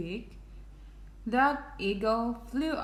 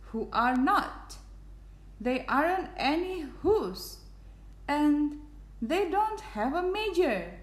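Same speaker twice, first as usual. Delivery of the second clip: mild storybook shout, pitched higher; 7 semitones higher than usual. A narrator's reading. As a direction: scold in a higher, lightly raised voice. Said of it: "came shouting".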